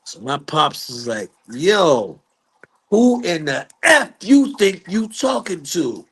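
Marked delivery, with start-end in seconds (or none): 1.5-6.1 high, indignant challenge; retold squaring up to a rude man; angry confrontation. Father answering Joe Jackson's insults, angry challenge.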